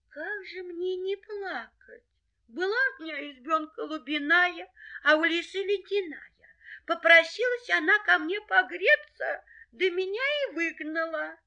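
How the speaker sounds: high, plaintive hare voice; weeping little hare. Acted. Hare tells dogs of losing his hut, tearful. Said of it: "идёт дорогой и плачет".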